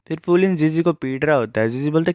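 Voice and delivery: ordinary delivery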